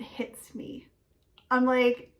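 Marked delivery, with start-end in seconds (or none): 0.0-0.9 hushed whisper of realisation; secretive storytelling hush. Real, not acted. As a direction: drop to a whisper for the reveal.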